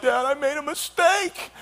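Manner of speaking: high, soft, tearful plea; young husband to his dad, mock tearful; whiny confession. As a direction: whimper it to your dad like a crying kid. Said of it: "going into my dad's office and like".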